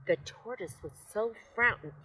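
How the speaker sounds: ordinary delivery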